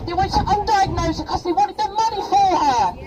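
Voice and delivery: ordinary talk, nothing marked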